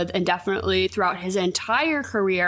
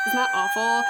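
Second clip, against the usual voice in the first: shocked. Real, not acted. Sharing shocking news.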